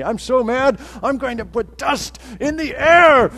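high, loud hammed-up anger; mock angry crowd. Pastor mocking the angry crowd, hammed-up shout. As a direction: play the furious crowd for a laugh. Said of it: "which is interesting to me".

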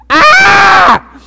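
very high, very loud scream of pain. Retold or imitated. Pastor imagining being flogged, pained scream. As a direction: shriek as if the whip just hit. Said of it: "I would probably be saying something like".

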